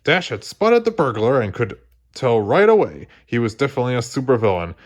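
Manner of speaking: usual speaking voice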